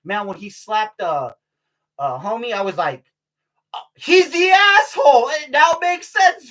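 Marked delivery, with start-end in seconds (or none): 3.7-6.5 high, loud, drawn-out outburst; loud realization. Retold or imitated. Reacting to the actor's slap, loud sudden realization. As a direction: retell a revelation at full volume.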